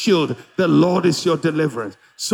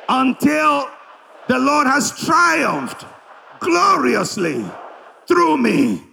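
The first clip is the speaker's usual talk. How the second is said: loud, drawn-out preacher's declaration; preaching at full force; 9 semitones higher, 3 dB louder than usual. Acted. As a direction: proclaim it slowly to the congregation. Leads congregation in declaration.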